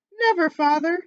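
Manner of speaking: put-on loud, high cry of children; cried out. A narrator's reading. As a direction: call it out together, high and loud. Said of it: "cried they all".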